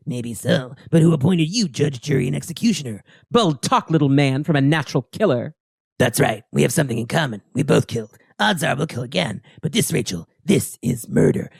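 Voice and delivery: low-voiced grim antihero; grim rebuke. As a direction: answer low and measured, passing judgment. Acted comic dialogue, Wolverine answering Rachel.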